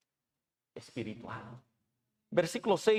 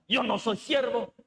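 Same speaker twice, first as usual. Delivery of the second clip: higher, louder, indignant declaration; proud refusal; 7 semitones higher, 5 dB louder than usual. Retold or imitated. Preacher voices the carnal refusal to serve, indignant and louder. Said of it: "Nuestra reacción carnal".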